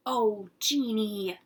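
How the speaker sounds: a mom shocked by the mess; dismayed mother. Acted. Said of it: "my mother cried".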